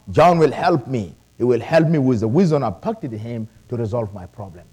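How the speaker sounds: ordinary delivery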